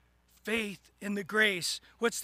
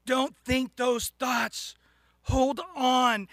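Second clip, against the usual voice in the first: loud, slow, punched-out words; forceful exhortation; 6 dB louder, slower than usual. Real, not acted. Preacher tells congregation to hold on, loud and emphatic. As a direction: drive each word home to the listeners.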